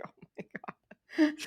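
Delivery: whispered eye-roll; exasperated aside. Retold or imitated. Acts out rolling her eyes.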